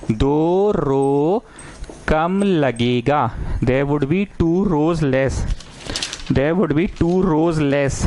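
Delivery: very slow, spaced dictation; teacher dictating the problem to students, very slow. Acted. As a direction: read it out slowly so everyone can write.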